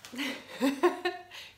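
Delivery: a loud nose blow. Real, not acted. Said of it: "Excuse me while I blow my nose".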